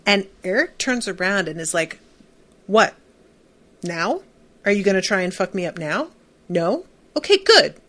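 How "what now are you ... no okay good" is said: mock defiant comeback. Eric turns on Adam at the dance, defiant. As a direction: act out facing down a bully.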